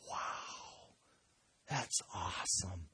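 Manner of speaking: very soft, slow marvelling; hushed awe. Acted. Potter peering into glowing kiln, hushed awe. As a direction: breathe it out in wonder.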